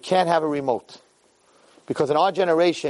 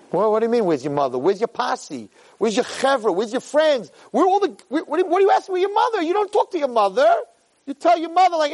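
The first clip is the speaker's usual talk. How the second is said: acted indignant questioning, climbing high; mock outrage, pitch rising steadily; incredulous challenge; 9 semitones higher than usual. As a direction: build from puzzled to high, heated disbelief. Acted challenge to dying kid.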